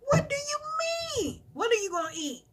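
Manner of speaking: high, startled disbelief; incredulous. Retold or imitated. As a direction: ask it back in disbelief. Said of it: "reply to daughter".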